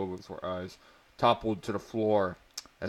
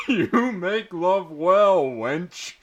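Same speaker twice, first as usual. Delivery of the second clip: real giggles breaking up a macho line, louder and higher; can't keep it together, laugh it out; laughing through the line; 9 semitones higher, 9 dB louder, slower than usual. Real, not acted. Reading a cheesy barbarian romance aloud, laughing helplessly.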